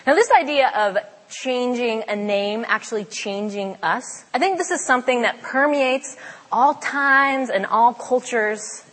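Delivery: ordinary talk, nothing marked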